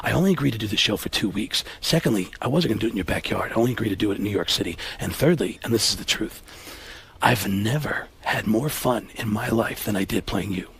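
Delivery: low, soft, earnest leading man; Alec Baldwin. Acted. Baldwin placating Pacino about the play, low and sincere. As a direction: explain it point by point, hushed and deep. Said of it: "And he said".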